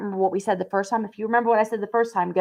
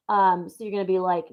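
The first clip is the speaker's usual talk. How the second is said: ordinary talk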